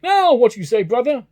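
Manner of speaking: high, loud gloating at his brother; triumphant crowing. Acted. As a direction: crow over the win, loud and high. Gentleman gloating after winning bet.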